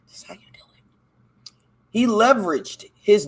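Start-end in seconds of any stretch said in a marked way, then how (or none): 0.1-0.8 breathy hushed whisper. Real, not acted. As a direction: lean in and whisper it like a secret. Secret aside to viewers.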